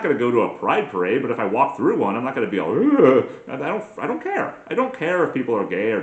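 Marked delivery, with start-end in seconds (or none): 2.7-3.3 loud, raised "ugh" of disgust; mock disgusted groan. Imagined reaction to the parade, loud mock disgust. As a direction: groan as if revolted, put on.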